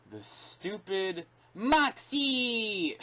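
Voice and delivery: mock a cringy battle cry; mocking catchphrase. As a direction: sneer out the catchphrase. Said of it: "I hate the way they say it".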